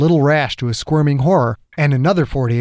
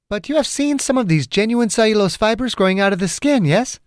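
much higher-pitched heroine's voice; persistent woman caller; 9 semitones higher than usual. Acted. Margaret presses Chang about genuine fibers, persistent. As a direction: press the question in a high female voice.

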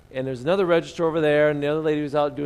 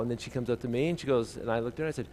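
ordinary talk, nothing marked

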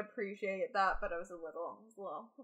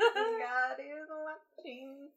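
eerie; 3 semitones higher, much slower than usual. Pretend. Repeats the line, slower and eerier.

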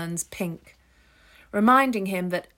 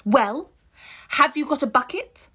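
louder and higher, impatient demand; angry demand; 7 semitones higher, 7 dB louder than usual. Acted. Ruth demanding a bucket from the stranger, angry and loud. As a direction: snap the question at him. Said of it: "and angry".

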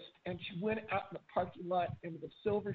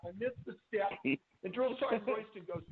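ordinary talk, nothing marked

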